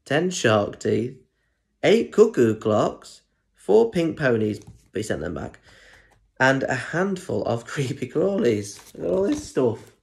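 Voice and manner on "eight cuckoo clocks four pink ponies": plain reading voice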